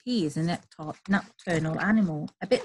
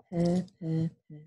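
purring; 2 semitones lower, 5 dB quieter than usual. Pretend. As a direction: purr like a sleepy cat. Cat goodnight sound.

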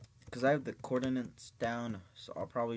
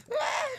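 sharp yelp; 11 dB louder than usual. Real, not acted. Kills the sheep.

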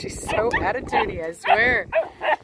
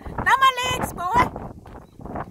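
pained squeal; much slower than usual. Real, not acted. Puppy bites her legs.

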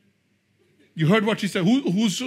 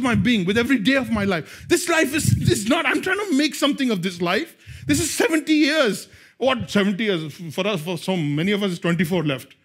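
preaching at full force; 3 semitones higher than usual. Acted. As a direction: build the fire on every line.

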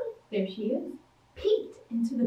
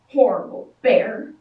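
angry refrain; 4 semitones lower, 7 dB louder than usual. A narrator's reading. Girl stomping angry refrain.

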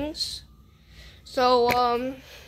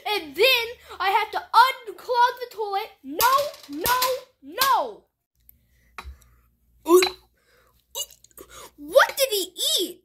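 outraged; 7 semitones higher, 6 dB louder than usual. Acted. Chef outraged at extra work.

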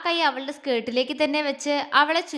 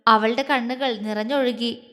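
ordinary delivery

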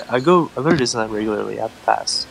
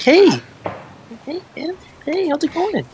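mock friendly greeting. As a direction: coo a put-on friendly greeting. Cops' friendly greeting, acted again.

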